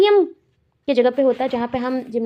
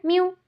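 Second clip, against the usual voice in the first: high squeaky cat meow; mimicked meow; 7 semitones higher than usual. Shown as a demonstration. Teacher mimics a cat for the class. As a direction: mew up high like a kitten. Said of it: "cat क्या कहता है".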